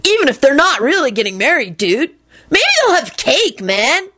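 high whiny dude voice; swooping, hopeful wheedle; whining. A narrator's reading. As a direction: keep pleading in a whine. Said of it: "Kenny whined loudly".

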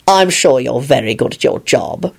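low, slow courtesy; placating. A narrator's reading. Lupin mollifies the guard, placating. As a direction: soothe him slowly and civilly. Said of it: "Lupin told the man".

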